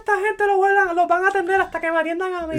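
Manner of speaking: high, loud bratty demand; high mock demand. Customer demanding service, high, loud mock demand.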